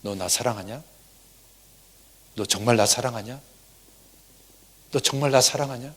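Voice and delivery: soft, hushed questions; Jesus quietly asking Peter; gentle asking as Jesus. Acted. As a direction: ask tenderly, close to the ear. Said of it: "똑같은 질문을 세 번 하는 겁니다".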